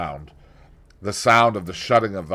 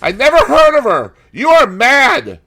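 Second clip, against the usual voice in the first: much higher, louder, slowed terrified plea; panicked cry; 13 dB louder than usual. A narrator's reading. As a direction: beg for your life in panic. Dorian panics before gun.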